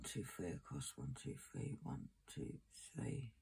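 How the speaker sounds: soft murmured counting; counting under breath. Real, not acted.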